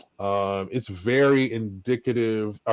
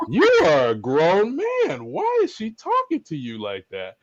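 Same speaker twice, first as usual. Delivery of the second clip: inner demon; 10 semitones higher than usual. Retold or imitated. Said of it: "the demon in my mind was like".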